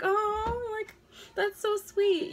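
high, touched mock gush. A creator touched by subscribers, cooing high. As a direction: coo it like you're deeply touched. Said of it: "I'm like".

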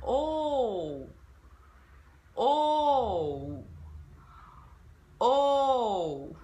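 a vocal coach leading a drill; model O for repeating. Shown as a demonstration. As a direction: drill オー for students to copy. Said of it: "では一緒にやってみましょう".

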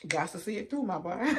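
ordinary talk, nothing marked

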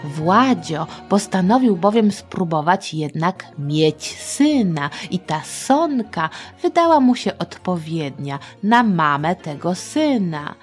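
plain reading voice

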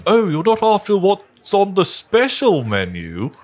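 high sly character voice; lilting, teasing innkeeper; sly matron. Acted. Matron teases adventurers about the special menu.

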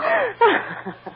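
a little laugh close to tears; tearful giggle. Acted. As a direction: giggle while holding back tears. Child hugging mother, moved.

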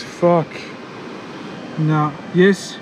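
ordinary talk, nothing marked

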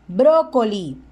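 Brócoli with Spanish tapped r and open o. Shown as a demonstration. Teacher contrasting Spanish with English: Spanish pronunciation. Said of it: "debe notar el cambio de inglés a español".

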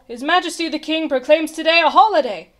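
loud, high royal announcement; called proclamation. A narrator's reading. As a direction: proclaim it like a messenger. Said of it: "he called".

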